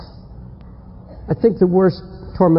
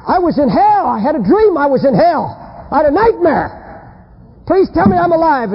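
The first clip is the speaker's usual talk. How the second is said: high-pitched, frantic pleading; 8 semitones higher than usual. Acted. Man telling his wife he dreamed of hell, frantic. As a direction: plead in panic after a nightmare.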